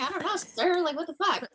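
high, whiny helplessness; high mock desperation. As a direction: act lost and desperate. Retold desperate answer.

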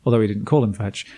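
ordinary talk

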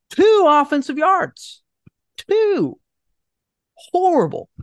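high, loud and slow, disbelieving; exasperated outburst. Real, not acted. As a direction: spit out the numbers in outrage. Exasperated rant about two yards.

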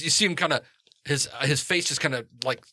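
ordinary talk, nothing marked

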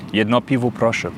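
"Jedno piwo proszę" said in Polish. Shown as a demonstration. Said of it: "ich kann übrigens auch ein Bier auf Polnisch bestellen". ("Jedno piwo proszę" auf Polnisch gesprochen)

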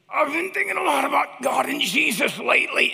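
put-on loud, high toddler voice; high-pitched kid impression, at full volume; little daughter. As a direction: imitate a small girl announcing her big thought. Voicing his little daughter in story.

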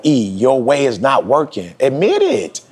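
retold self-talk, climbing high; self-scolding. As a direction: scold yourself until you cave. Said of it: "I was like".

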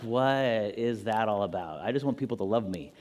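lightly voiced Jesus, low and soft. Jesus answering the angry believer, lightly voiced, low and soft. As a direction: answer softly, low and puzzled. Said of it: "Jesus is like".

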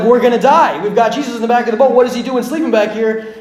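loud, high-pitched acted panic; freaking out. Disciples in the storm-tossed boat, loud acted panic. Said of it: "the disciples are freaking out".

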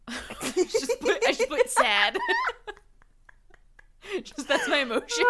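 high, helpless laughter through the words; real laughing fit. Real, not acted. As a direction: squeeze it out while cracking up. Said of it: "I'm laughing".

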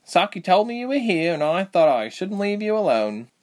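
lightly voiced young woman, slightly annoyed; Reshma's voice. A narrator's reading.

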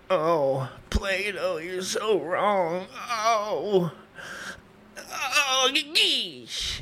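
drawn-out mock moaning, climbing high; Diogenes pleasuring himself. Philosopher arguing while masturbating in public, hammed-up moans. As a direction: moan the argument, building to a high climax. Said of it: "periodically masturbate in public".

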